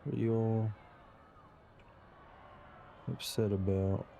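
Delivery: ordinary delivery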